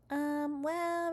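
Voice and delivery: high, drawn-out stalling; hesitant child. A narrator's reading. Child asked by Mum to explain, high hesitant stalling.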